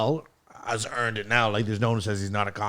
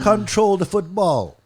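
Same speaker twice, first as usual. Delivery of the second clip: put-on French Canadian accent; 8 semitones higher, 6 dB louder, slower than usual. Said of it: "that was a fucking French Canadian accent".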